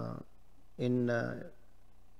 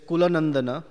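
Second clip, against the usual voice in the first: Kulanandana called out louder with a mocking rise; queen mocking Ravana as Kulanandana; sarcastic title; 3 semitones higher, 6 dB louder, faster than usual. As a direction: sneer the honorific as an insult. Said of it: "this is sarcasm".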